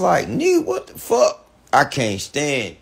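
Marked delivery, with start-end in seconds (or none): 0.3-1.5 mock disbelief, squeaked way up high; shocked squeal. Retold shocked reaction to Tyrese.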